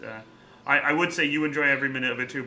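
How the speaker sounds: ordinary delivery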